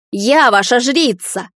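loud drawn-out outcry; indignant shout. A narrator's reading. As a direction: cry it out, stretching the words. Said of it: "тем временем кричала она".

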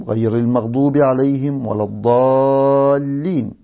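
low, measured recitation of the Fatiha; Quran recitation. Acted. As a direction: recite it like a reverent boy reading scripture.

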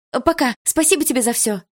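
bright, high, happy farewell; cheerful goodbye. Acted. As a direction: call out thanks as you run. Girl waves, runs off happy.

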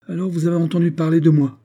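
high, loud, quick self-important question; ironic bombast. A narrator's reading. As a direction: announce yourself with ironic grandeur. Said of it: "avec une sorte d'emphase ironique".

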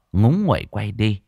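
plain reading voice

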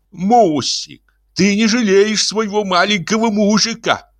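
put-on high baby-talk whine; pouting, high-pitched complaint; childish pleading. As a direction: wheedle the wife like a little boy. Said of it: "свернувший уже привычные губы в трубочку".

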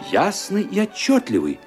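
normal talking voice

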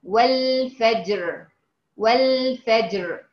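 والفجر slowly, qalqala on jeem then a heavy raa; slow correct recitation of والفجر; correct demo. Shown as a demonstration. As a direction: bounce the jeem and land on a full raa in والفجر. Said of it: "عشان أجيب حرف الراء".

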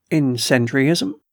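usual speaking voice

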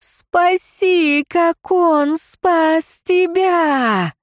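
high, drawn-out imploring cry; keening entreaty; wailing plea. A narrator's reading. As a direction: wail it slowly, high in the voice. Wailing plea urging the servant.

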